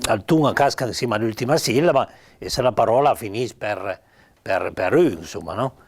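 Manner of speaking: ordinary talk, nothing marked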